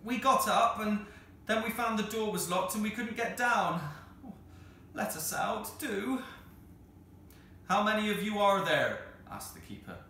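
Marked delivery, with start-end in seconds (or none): 0.0-6.2 raised pleading voice; calling out. Acted. Children pleading through door. 7.7-9.1 calling out. Acted. Keeper calls through door.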